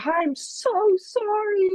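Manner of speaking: high, slow apology in dad's voice; apologetic dad. Retold or imitated. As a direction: pitch dad's sorry way up and draw it out. Dad apologizes after near fall.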